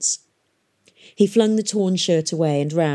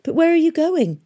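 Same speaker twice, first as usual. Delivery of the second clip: high, loud, anxious question; urgent boy; 12 semitones higher, 5 dB louder than usual. A narrator's reading. Boy chasing girl across the mud, urgent. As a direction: call the question out after her.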